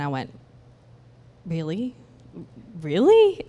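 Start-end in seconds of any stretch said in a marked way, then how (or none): 1.5-1.9 understated doubt with a rising end; doubtful. Retold or imitated. Doubting reply to inner word. 2.8-3.4 high, loud disbelieving question; incredulous. Retold or imitated. Repeats the doubt, far more incredulous.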